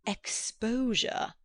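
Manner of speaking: slow, drawn-out hissed word. A narrator's reading. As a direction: hiss it out slowly with menace. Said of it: "hissed Mister Eager".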